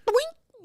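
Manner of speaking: high squeaky cartoon doink; silly doink. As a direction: yelp it like a cartoon. Playful poke sound.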